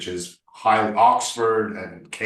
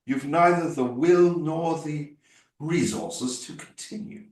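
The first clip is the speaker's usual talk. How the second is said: put-on upper-class British statesman, raised pitch; count or politician; 6 semitones higher than usual. Actor demonstrating a count or politician, lofty British delivery. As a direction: declaim it like an educated British politician. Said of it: "if I'm playing a count or".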